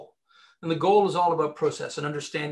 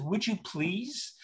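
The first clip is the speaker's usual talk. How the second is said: slow, soft, pleading ask; 2 semitones higher, 5 dB quieter, much slower than usual. Retold or imitated. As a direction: beg them gently and slowly. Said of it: "I said".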